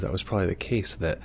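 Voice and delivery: ordinary talk, nothing marked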